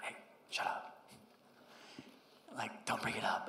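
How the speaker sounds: hushed, hissing whisper; a mom whispering sharply to hush her son; urgent whisper. Retold or imitated. Mom hushing child at shelter.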